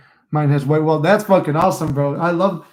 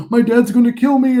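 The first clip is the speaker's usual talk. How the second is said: higher, louder imitated panic; panicked plea; 8 semitones higher, 7 dB louder than usual. The driver in his forties fearing his dad, a higher louder panicked whine. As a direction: mimic the scared driver fretting about his dad. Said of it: "he's like".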